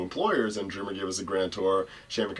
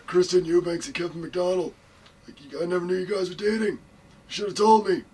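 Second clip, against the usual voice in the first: higher-pitched mock executive voice; Johnny Ace; 8 semitones higher than usual. Executive learning two recruits are dating, put-on voice. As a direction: imitate a boss in a raised, put-on voice.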